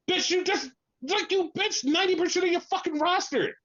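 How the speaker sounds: high-pitched, outraged comeback; indignant retort. Acted. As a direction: fire back in indignant disbelief. Indignant retort to quoted claim.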